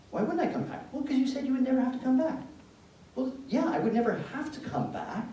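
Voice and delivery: high, loud insistent protest; exasperated arguing. Retold or imitated. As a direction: play a baffled son arguing with his mom. Acted mother and son argument.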